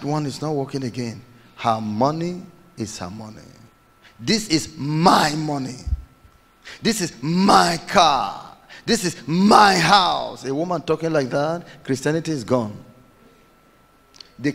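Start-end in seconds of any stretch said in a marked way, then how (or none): 4.2-10.5 loud, slow, possessive claim to each thing; preacher mimics a wife boasting of her things, slow and loud; mock defiant wife.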